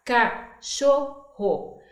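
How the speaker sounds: "Cachorro" very slow, split into syllables; segmented into syllables. Shown as a demonstration. Teacher segmenting a word for children, slowly broken into syllables. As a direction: stretch "Cachorro" apart syllable by syllable. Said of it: "tirar a palavra cachorro e segmentar".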